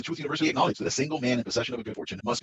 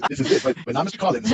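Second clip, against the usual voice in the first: ordinary talk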